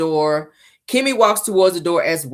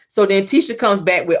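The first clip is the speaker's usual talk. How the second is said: normal talking voice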